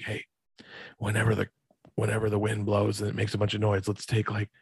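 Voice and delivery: soft, trailing into a whisper at the end; hushed retelling. Retold or imitated. Hiding friend plots with Brett in the corn, hushed.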